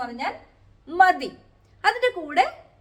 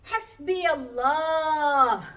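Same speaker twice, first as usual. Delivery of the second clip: slow recitation; 2 semitones higher, 4 dB louder, much slower than usual. Shown as a demonstration. Teacher recites Quranic phrase.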